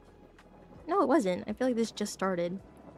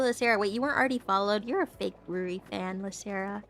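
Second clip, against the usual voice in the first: ordinary talk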